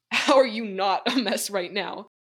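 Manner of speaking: ordinary delivery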